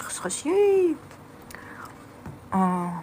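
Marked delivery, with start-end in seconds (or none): 0.0-1.0 high, squeaky mock crunch sound; imitated chewing noise. Mocking the noisy eaters she hates, squeaky chewing imitation. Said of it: "إذا حدا عم ياكل هيك".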